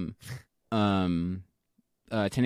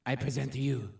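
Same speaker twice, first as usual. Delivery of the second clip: French pirate; 2 semitones lower, 6 dB quieter than usual. Acted. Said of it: "Why am I sounding like a fucking French pirate?".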